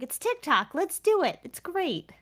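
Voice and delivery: acting out upbeat persuasion, pitch lifting; retold eager urging. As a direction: coax them like an eager enthusiast. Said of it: "I'm like".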